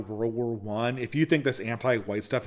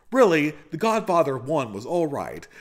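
high-pitched, swooping mock film-critic monologue; Patrick Bateman; 6 semitones higher than usual. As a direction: parody the American Psycho killer reviewing earnestly. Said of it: "part of the Patrick Bateman routine".

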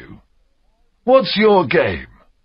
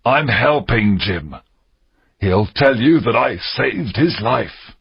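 mildly fearful pirate, measured pace; trembling voice; 3 semitones higher than usual. A narrator's reading. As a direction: plead for help with a slight tremble. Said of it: "Silver's voice trembled".

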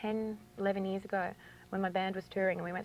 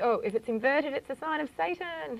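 higher-pitched mock alarm, drawing out SATAN; 8 semitones higher than usual. Witch imitating people who misread the pentagram, mock-alarmed. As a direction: imitate people panicking over a sinister symbol. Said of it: "so many people see this and they think".

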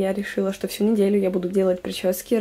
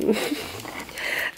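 breathy laugh; 7 dB quieter than usual. Real, not acted. Laughing at messy desk.

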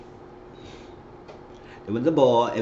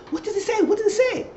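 high-pitched eager repeated question; eager squeal. Retold or imitated. Friend begging to hear the callback news, high squeal. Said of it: "she said".

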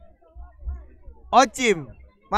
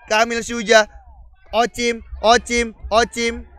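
ordinary delivery

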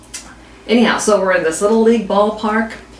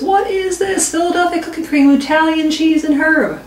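higher, amazed catcher voice; a baffled catcher reads the label aloud; confused catcher; 6 semitones higher than usual. Retold or imitated. Catcher finds cooking cream in his mitt, lightly voiced confusion. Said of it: "And he says".

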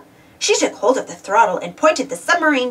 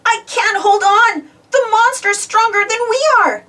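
high, loud straining plea; desperate cry; 12 semitones higher, 5 dB louder than usual. A narrator's reading. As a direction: Pablo tugging the cable against the monster, desperate cry. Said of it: "cried legend hunter Pablo".

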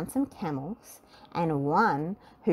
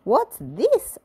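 curious question; 7 semitones higher, 6 dB louder than usual. A narrator's reading. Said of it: "asked Little Miss Tiny".

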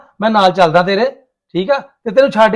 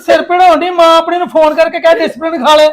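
loud, high-pitched irritation; fed-up shouting in a quarrel; exasperated retort; 7 semitones higher, 5 dB louder than usual. Acted. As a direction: throw it back at the wife, voice raised. Husband snaps back in quarrel.

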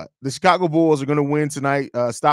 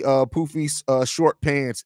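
usual speaking voice

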